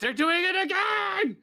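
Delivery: high excited yell; shouting. Real, not acted. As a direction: yell it out in disbelief. Friends shouting at game chaos.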